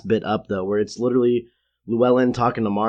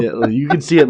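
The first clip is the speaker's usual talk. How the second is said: ordinary talk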